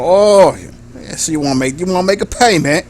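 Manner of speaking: sleazy British gangster, lively lilt; sleazy British accent. Acted. Gang member weighing an offered payment, sleazy British accent. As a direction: ham up a sleazy British crook. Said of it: "like, a sleazy British accent".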